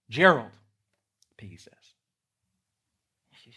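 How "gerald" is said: calling out. Shown as a demonstration. Piggy interrupts Gerald.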